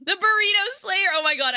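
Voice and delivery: excited. Real, not acted. Excited reaction to a name.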